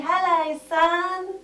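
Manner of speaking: high, bright greeting to the sun; cheery hello. Shown as a demonstration. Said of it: "wave to the sun and say".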